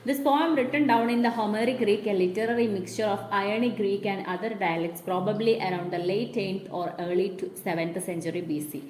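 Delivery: reading the English text very slowly for notes; measured classroom dictation. Shown as a demonstration. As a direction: read it out slowly so the class can write it down.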